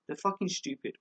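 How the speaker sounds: ordinary talk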